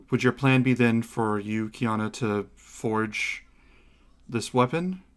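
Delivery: lightly voiced reserved goddess, with deliberate pauses; measured, formal goddess. Goddess apologizes and asks about forging a weapon, lightly measured. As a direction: apologize with restrained, careful formality.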